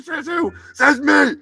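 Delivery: loud angry insistence; heated quarrel. Acted. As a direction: shout down the other puppet. Quarrel over director role.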